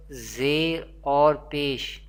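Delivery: usual speaking voice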